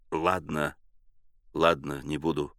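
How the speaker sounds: low, soft grumble; muttering. A narrator's reading. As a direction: mumble a reluctant promise. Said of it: "бормотал Щур".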